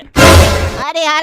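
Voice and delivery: long, loud, drawn-out "अरे यार"; exasperated groan. Acted. (लंबा खींचा हुआ झुंझलाया "अरे यार")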